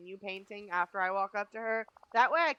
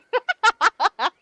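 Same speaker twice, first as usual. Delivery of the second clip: laughing; 11 semitones higher, 15 dB louder than usual. Real, not acted. Laughs at ad timing.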